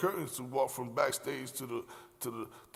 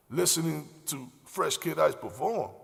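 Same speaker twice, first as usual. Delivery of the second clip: ordinary talk, nothing marked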